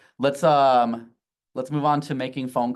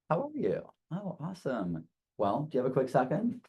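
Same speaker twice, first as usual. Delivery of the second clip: friendly phone voice; 4 semitones higher, 6 dB quieter than usual. Shown as a demonstration. Cheerful phone call demo.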